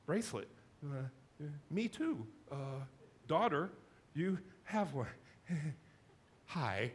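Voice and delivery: halting, fumbling mumble with long gaps; nervous mumbling. Retold or imitated. Starstruck fan mumbling to his hero. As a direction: stammer out broken words, starstruck. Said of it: "I just started mumbling".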